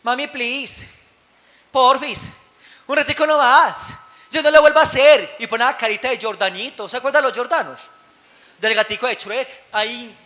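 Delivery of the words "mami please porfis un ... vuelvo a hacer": a lightly voiced wheedling kid, rising; mock child pleading for television. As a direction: beg mommy like a spoiled child. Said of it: "llegan y son".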